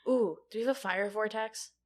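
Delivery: ordinary talk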